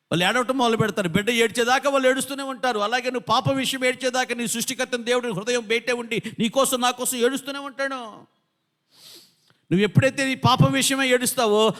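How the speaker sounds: high, urgent exhortation; impassioned preaching. Real, not acted. Preacher telling congregation God weeps until they repent, impassioned and urgent. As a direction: press the appeal with rising force.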